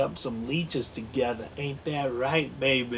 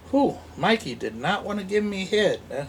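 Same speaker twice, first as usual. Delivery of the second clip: ordinary talk, nothing marked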